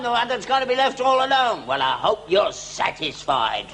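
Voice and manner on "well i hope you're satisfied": normal talking voice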